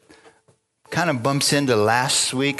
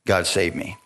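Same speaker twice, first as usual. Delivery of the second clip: usual speaking voice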